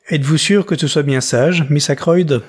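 ordinary delivery, nothing marked

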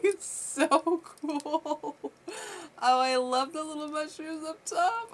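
high, stretched squeal of delight; real giddy excitement. Real, not acted. Vlogger adoring the little mushroom figure, giddily excited. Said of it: "that was so exciting".

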